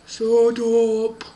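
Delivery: loud, drawn-out booming order; gruff doorkeeper. Acted. Person behind the door silences the family, loud booming voice. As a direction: boom it at the captives. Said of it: "the person behind the door was like".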